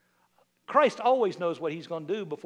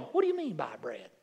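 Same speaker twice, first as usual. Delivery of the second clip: a bemused, lower mock question; puzzled church members; 8 semitones lower, 5 dB quieter than usual. Church members puzzled by Jesus' request, mock bewildered. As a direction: play the confused congregation. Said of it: "half the church is going".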